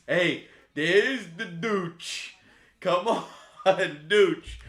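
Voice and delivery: slow, loud, laughing call; excited greeting. Real, not acted. Streamer spotting a new horse name, delighted.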